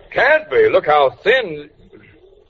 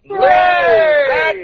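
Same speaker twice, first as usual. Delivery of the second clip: cheering. Acted. Cheers the wives' diet.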